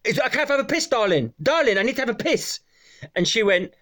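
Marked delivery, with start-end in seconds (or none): 0.0-2.6 higher-pitched retold plea; lightly voiced man desperate for the toilet; impatient customer. Man asking a cleaner to let him in, mock pleading.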